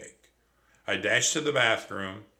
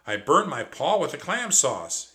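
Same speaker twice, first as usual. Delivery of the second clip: complaining; 5 semitones higher, 6 dB louder than usual. Acted. Trap hurt and complaining.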